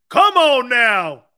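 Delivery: slow, high, loud come on now; stretched-out incredulous protest; loud exasperation. Real, not acted. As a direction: drag it out in disbelief. Exasperated at caller's claim.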